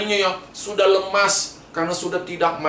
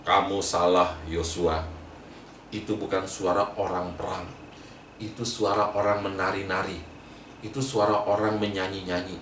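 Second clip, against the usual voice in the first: lower, softer voice of Moses, patiently correcting Joshua; a calm old leader setting the record straight; gently firm Moses, low and quiet; Moses speaking; 10 semitones lower, 4 dB quieter than usual. Acted. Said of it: "Musa bilang".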